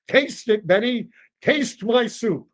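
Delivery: high, drawn-out insistence; insistent old customer. Acted. Old diner cutting off owner's questions, insistent. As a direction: demand it in a swooping high voice.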